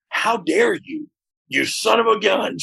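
high, slow, playfully outraged; a hint of a wounded ego; mock indignation. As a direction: act insulted but keep it light. Mock indignation at being fired.

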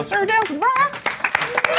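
high, slow mock-ceremonial thanks; grand announcer. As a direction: proclaim it like a herald.

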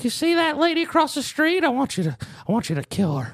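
put-on high old man voice plotting; high-pitched mock menace; sinister old man. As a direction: imitate a sweet old man ordering a hit. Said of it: "He's like".

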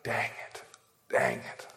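hushed, defeated self-reproach; regretful whisper. Retold or imitated. Preacher realizing he missed a chance to comfort, regretful whisper. Retells his regret at denying.

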